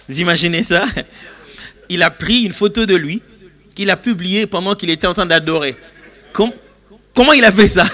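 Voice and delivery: loud, high, incredulous laughing delivery; laughing disbelief. Real, not acted. Preacher mocking worship selfies to congregation, loud amused disbelief.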